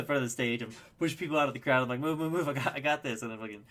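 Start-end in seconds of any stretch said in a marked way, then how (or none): 2.0-3.2 higher, louder, hurried re-enactment; retold urgent call. Pushing through a crowd to the stage, retold urgent call. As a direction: imitate calling people out of the way.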